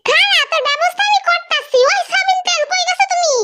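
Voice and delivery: high-pitched nagging mother voice; scolding mother. Acted. Mother scolds her daughter about housework, high-pitched and nagging.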